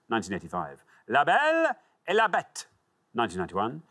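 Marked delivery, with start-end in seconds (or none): 1.1-2.7 high, swooping French title read; showy French pronunciation; French accent. Acted. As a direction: announce it with theatrical French flair. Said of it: "a French accent, though, don't you".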